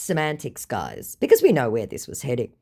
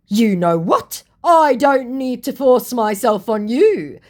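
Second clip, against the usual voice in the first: higher, louder huffy retort, stretched out; mock huffy scammer; 10 semitones higher, 8 dB louder, slower than usual. Scammer refusing to push himself on his target, mock huffy. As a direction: act out an affronted scammer.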